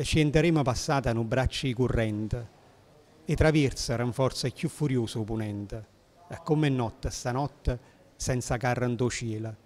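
put-on poem recited in old Neapolitan dialect. Shown as a demonstration. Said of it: "scrive in un napoletano antico".

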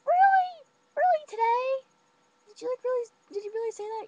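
very high, loud incredulous questions; can't believe he said that; shocked disbelief. Retold or imitated. Rachel grilling her friend about the answer, very high shocked disbelief.

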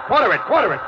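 captain snapping an order on the bridge; urgent order. Acted. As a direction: bark it at the crew, no time to lose. Captain barking orders at crew.

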